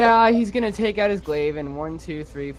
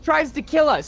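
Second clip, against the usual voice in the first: high-pitched in-character rant; exasperated scolding; 10 semitones higher, 4 dB louder, faster than usual. Acted. Companion scolds Eamon for touching things, exasperated scolding. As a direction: complain loudly in a raised, fed-up pitch.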